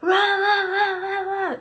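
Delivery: high mock roar of a mob; wordless high "rah-rah" clamor; imitated crowd yelling. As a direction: imitate a crowd's yells, pitched well up. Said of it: "we hear the yells getting louder and louder".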